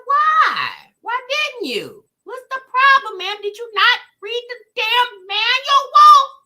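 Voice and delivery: high, loud, drawn-out disbelief; exasperated mock scolding. Host replays scolding sis over the uncharged car, exasperated. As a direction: scold her with hammed-up incredulity. Acts out scolding the stranded woman.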